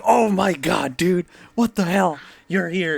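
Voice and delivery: high-pitched mock amazement; retold excitement. Members spotting Wakabayashi on the small bus, mock excited. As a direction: imitate thrilled, astonished members. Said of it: "They got all excited".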